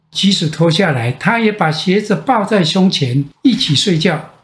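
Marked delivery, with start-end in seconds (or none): none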